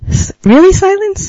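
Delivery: high mock-shocked really silence; mock disbelief. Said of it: "I was like".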